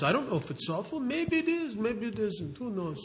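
put-on old man. Old man shrugs a third time.

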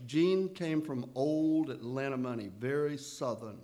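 usual speaking voice

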